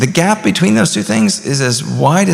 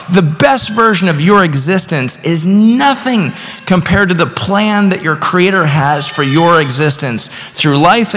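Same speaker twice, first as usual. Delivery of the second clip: fervent preaching; 5 semitones higher than usual. Acted. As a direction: build it up like a revival preacher. Preaching at full force.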